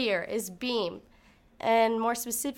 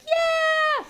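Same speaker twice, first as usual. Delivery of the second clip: very high, loud cry of triumph; a screaming yeah after landing the series; triumphant yell; 7 dB louder than usual. Retold or imitated. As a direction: let out a huge yell of relief. Landed the beam series.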